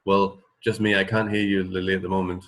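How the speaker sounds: usual speaking voice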